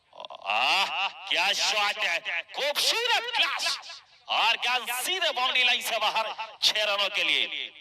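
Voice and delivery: high, slow, marvelling call; excited cricket commentary. Real, not acted. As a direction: savour the six, voice soaring. Commentator reacts to a six.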